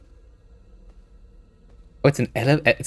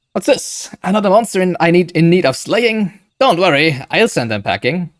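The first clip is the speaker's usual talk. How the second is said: hero voice; 7 semitones higher, 5 dB louder than usual. Acted. Reads game dialogue aloud.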